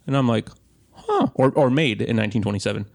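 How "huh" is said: high surprised huh; a squeaky, startled huh; mock surprised huh. Seeing the 1927 bottle, a high startled huh. Retold reaction to price.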